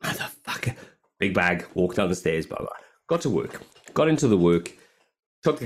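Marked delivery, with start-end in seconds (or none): 0.0-0.8 under-the-breath curse at the dead lift; muttered curse. Retold or imitated. Retelling the lift being off, a muttered curse. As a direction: mutter it to yourself, fed up. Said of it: "And I'm like".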